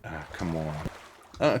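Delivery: ordinary talk, nothing marked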